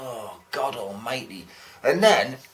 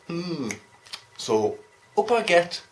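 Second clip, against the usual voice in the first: ordinary talk, nothing marked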